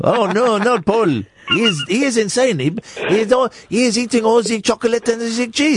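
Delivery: mock-alarmed Swiss accent. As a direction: fret in a silly Swiss accent. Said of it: "It's a Swiss accent".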